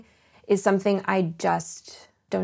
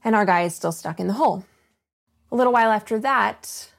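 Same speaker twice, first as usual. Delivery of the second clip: usual speaking voice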